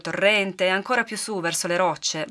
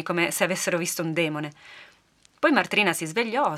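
ordinary delivery, nothing marked

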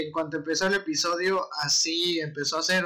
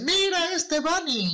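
squeaky voice; 12 semitones higher, slower than usual. Retold or imitated. Kids mocking him.

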